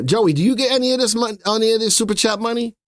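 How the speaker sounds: high-pitched, incredulous questioning; mock interrogation. Real, not acted. Host grills co-host Joey about chat money, high mock suspicion. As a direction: put Joey on the spot in a high, quick voice.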